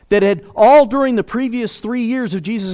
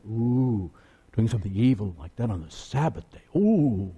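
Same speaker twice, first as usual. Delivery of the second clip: mock scandalized; 8 semitones lower, 6 dB quieter than usual. Mocking the pious congregation.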